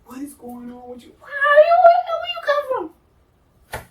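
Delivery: high, loud mock shriek of disbelief; alarmed questioning. Demanding why she turned up, mock shriek. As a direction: climb into an exaggerated, high-pitched yell. Retold startled questioning of her.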